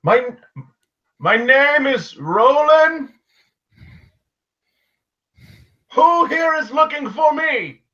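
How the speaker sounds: high, loud announcement to a crowd; calling out. Acted. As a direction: proclaim it high, stretching the words. Character announcing himself to noisy crowd.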